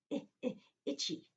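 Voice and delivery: "I, I, itchy" with the short i of itch; short I sound. Shown as a demonstration. Teacher showing kids the itchy short i. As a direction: mimic scratching an itch on "I". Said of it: "if you're scratching your arm, you go".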